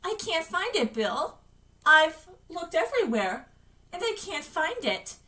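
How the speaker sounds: put-on high, plaintive ghost of a child; lost, wavering voice. Dead George to his brother Bill, lost and wavering. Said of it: "George's lost voice rose, wavering in the tunnel".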